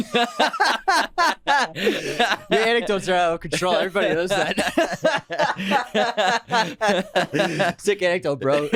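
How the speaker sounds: laughing through mock praise; giggling mock compliment; laughing teasing. Real, not acted. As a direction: tease him while cracking up. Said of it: "Really, really, I really enjoyed that".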